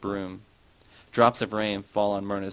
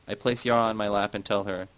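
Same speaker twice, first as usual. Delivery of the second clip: ordinary delivery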